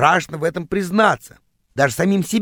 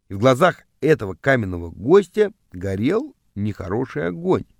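ordinary delivery, nothing marked

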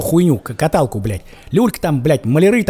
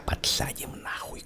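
whisper; 15 dB quieter, slower than usual. Retold or imitated. Agents threatening the hero.

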